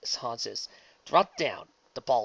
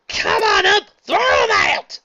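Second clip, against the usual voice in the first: high, loud outraged yell; shouting; 14 dB louder, slower than usual. Acted. Applejack demanding the umpire throw him out, loud shout. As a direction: yell it from the stands. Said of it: "Applejack shouted".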